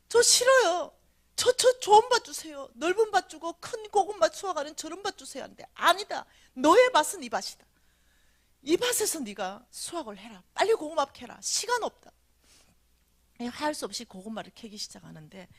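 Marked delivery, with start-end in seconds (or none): 0.1-5.4 loud, high whining plea; pleading refusal. Retold or imitated. Dreamer begging the three men for a better field, high whine. 5.7-12.1 firm refusal. Retold or imitated. Messengers refusing her plea.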